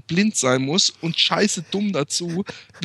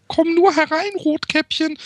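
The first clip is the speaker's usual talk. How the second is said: much higher, louder sweet granny voice; disguised wolf as granny; 4 dB louder than usual. Acted. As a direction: squeak it like a wolf faking grandma. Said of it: "so vor, wie ich es auch meiner Tochter vorlese".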